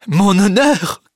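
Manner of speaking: high, loud, indignant question; crying out. A narrator's reading. Charles answers his cousin about saving his honour, loud cry. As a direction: cry it out in shock. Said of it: "crie le jeune homme".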